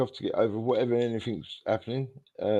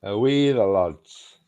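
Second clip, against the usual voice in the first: slow, stretched Geordie slipping into Scottish; Geordie attempt, rather Scottish; much slower than usual. Shown as a demonstration. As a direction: try Geordie and land nearer Scotland. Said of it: "that was kind of Scottish".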